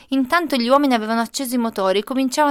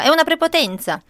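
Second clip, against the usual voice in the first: indignant protest; 6 semitones higher, 4 dB louder than usual. A narrator's reading. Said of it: "e la mamma diceva".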